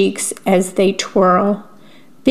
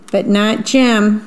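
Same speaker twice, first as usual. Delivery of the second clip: ordinary delivery, nothing marked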